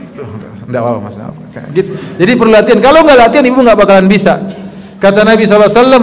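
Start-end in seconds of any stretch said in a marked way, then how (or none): 0.1-1.7 holding it in. Acted. Wife suppresses anger toward husband.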